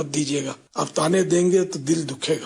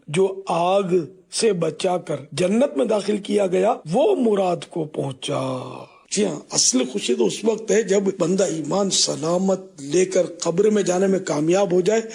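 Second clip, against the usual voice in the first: usual speaking voice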